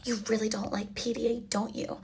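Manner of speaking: soft, whispered teasing; mock whisper. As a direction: whisper it like a smug date. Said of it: "he's like".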